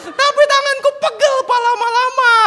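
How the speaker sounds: put-on loud, very high toddler whine; complaining child. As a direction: protest to dad in a little boy's squeal. Son complains of aching hand.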